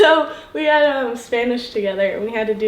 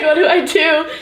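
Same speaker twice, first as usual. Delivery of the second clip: higher, louder, giggly helplessness; awkward; 6 semitones higher, 5 dB louder than usual. Retold or imitated. Classmate imitating Troy unsure how to dance, awkward. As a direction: imitate him flustered, with a laugh. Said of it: "he was so awkward about it".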